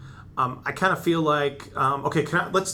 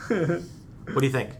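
ordinary talk, nothing marked

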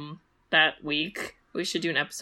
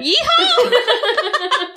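high, loud laughing yeehaw whoop; cowboy yeehaw; 9 dB louder than usual. Pretend.

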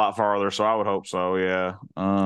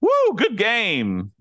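high, slow cheer; cheerleader; 12 semitones higher, 3 dB louder, much slower than usual. Retold or imitated. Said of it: "you sound like a cheerleader".

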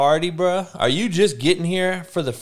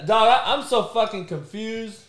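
normal talking voice

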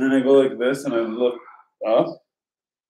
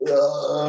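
imitated groan of trying to speak; strained groan; 4 semitones higher, 3 dB louder than usual. Man in sleep paralysis trying to wake her, strained groan. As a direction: push out a wordless, higher-pitched groan. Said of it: "trying to talk to her".

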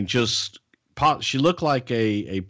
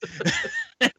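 high squeal of laughter; 3 dB quieter than usual. Real, not acted. Laughing at his own Freddie impression. Laughing after acted quote.

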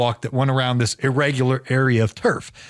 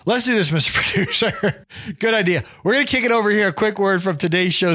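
playful excited; 6 semitones higher than usual. Real, not acted. Playful handoff to the producer.

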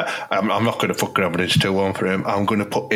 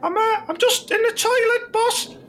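much higher squeaky player voice; timid young player. Acted. Player calling to his manager from the toilet, high timid voice. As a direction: answer the boss meekly from the toilet.

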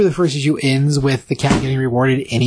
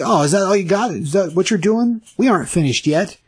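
higher, taunting challenge; taunting mech cat; 6 semitones higher than usual. Retold or imitated. Voicing Action Cinnamon taunting the mech suit, cocky higher taunt. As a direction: goad the opponent like a cocky fighter. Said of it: "He's like".

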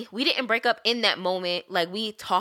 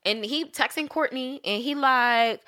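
ordinary talk, nothing marked

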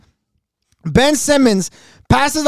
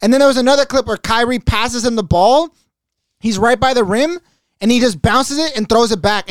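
ordinary talk, nothing marked

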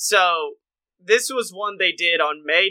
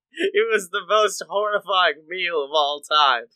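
ordinary talk, nothing marked

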